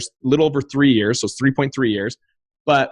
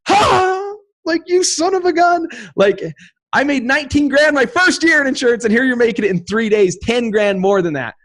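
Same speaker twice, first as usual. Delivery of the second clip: much higher, louder mock disbelief; incredulous excitement; 13 semitones higher, 4 dB louder than usual. Reacting to a fellow investor's quick profit, much higher and louder. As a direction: burst out in amazed envy. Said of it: "And I'm like".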